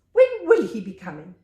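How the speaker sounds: loud impatient snap. Acted. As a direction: bark it out, fed up with waiting. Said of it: "he snapped".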